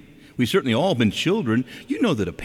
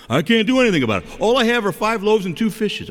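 high, louder mock complaint; overwhelmed protest; 11 semitones higher, 5 dB louder than usual. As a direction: protest helplessly as an overwhelmed believer. Acting out an overwhelmed listener's protest.

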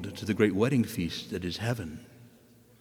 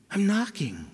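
higher, lightly voiced Jesus calling through the door; 9 semitones higher than usual. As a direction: call it out as if knocking.

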